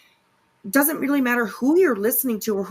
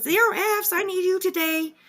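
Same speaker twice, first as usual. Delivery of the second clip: imitating her; 11 semitones higher than usual. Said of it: "She says".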